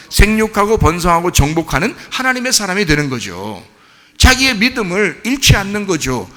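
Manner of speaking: normal talking voice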